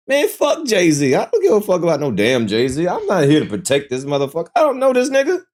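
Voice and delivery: high-pitched, worked-up dismissal; emphatic disavowal. Real, not acted. Host disowning [name] to co-hosts, high and indignant. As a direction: push him away with rising exasperation.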